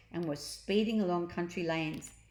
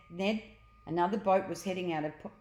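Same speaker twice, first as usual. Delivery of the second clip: ordinary delivery, nothing marked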